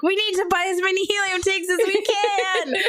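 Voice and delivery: high-pitched mock child panic; imitated alarmed child. Imitates her alarmed young son.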